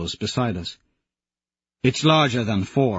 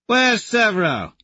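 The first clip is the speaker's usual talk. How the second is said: mocking call; 11 semitones higher than usual. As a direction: sing it out smugly. Tactus calling out.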